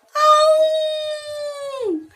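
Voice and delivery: high, long howling wolf cry. Acted. Wolf howling at the sight of prey, long and high. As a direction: howl like a hungry wolf. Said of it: "Begitulah suara raungan serigala".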